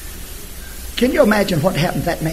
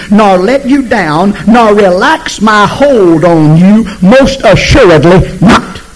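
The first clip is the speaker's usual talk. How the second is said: preaching scripture; 3 semitones higher, 6 dB louder, slower than usual. Acted. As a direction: proclaim each word slowly. Amplified scripture proclaimed.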